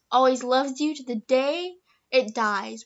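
normal talking voice

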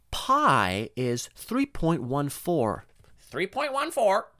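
slow, squawky high parrot echo; parrot mimicking teacher. A parrot repeating the teacher's lesson in slow squawks. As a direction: drag out the numbers like a parrot. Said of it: "the teacher would be like / parrot repeated".

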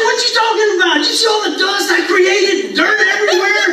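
high, laughing protest; arguing with the kids over his slide, outraged; indignant protest. Retold or imitated. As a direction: insist on the slide in mock outrage.

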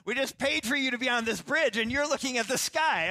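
loud mock scolding from the wife; mock wife complaint. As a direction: play the exasperated wife, hammed up. Mocking wife's complaint on bridge.

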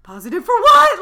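high, loud panicked question with a laugh; terrified alarm. Retold or imitated. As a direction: blurt it out in retold panic. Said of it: "Like, I was terrified".

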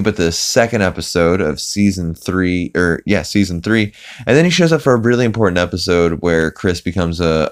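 ordinary delivery

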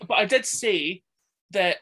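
ordinary talk, nothing marked

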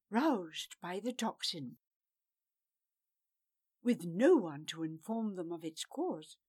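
ordinary delivery, nothing marked

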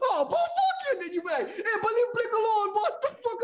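very high, laughing mock yell; mock cussing out. Friends cussing each other in the car, high laughing mock yell. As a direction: cuss him out, half laughing.

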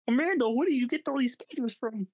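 high puppet frog, asking a question; Kermit the Frog. Acted. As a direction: question them in a cartoon frog voice. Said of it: "then Kermit the Frog goes".

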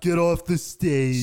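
loud, slow heckle; heckler shout. Pretend. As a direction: boo them off the stage. Heckling an imagined comedian.

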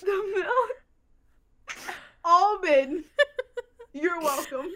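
high, loud, deadpan mock translation. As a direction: dub their broken Spanish like a silly interpreter. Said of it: "Do you wanna know what conversation you both just had?".

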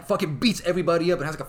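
normal talking voice

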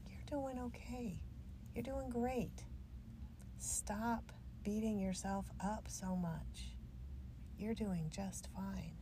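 slow, tender encouragement; slow, kindly comfort; gentle reassurance. Real, not acted. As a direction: reassure them softly, taking your time. Reassuring the listener directly.

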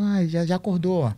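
a hint of a chirpy nurse voice; lightly voiced nurse. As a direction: play the nurse casually noticing. Voicing the nurse in story.

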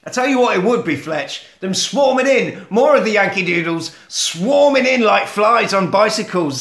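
high, loud mock rant; acted indignation.

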